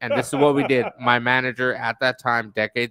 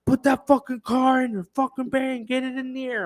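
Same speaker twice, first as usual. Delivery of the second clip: angry manager; 12 semitones higher than usual. Retold or imitated. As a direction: play the manager blowing up over the ruined car. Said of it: "he goes".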